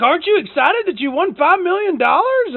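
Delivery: mock scammer voice. Scammer pressing the caller to be excited, mock pitch. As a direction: push him to be excited, cheesy.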